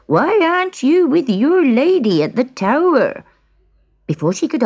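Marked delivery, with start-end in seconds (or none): 0.1-3.2 put-on high-pitched, astonished old man; acted shout. A narrator's reading. Old man questions his granddaughter, high acted shout. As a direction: demand it with rising pitch, mock shouting. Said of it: "shouted the old man".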